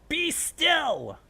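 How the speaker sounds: loud, high commanding yell; bellow. A narrator's reading. Princess halting a food fight, loud high bellow. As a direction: bellow the order over the noise. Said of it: "Luna bellowed".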